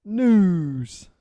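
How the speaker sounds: loud, drawn-out jingle shout; segment announcement. Acted. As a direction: belt it out like a segment sting.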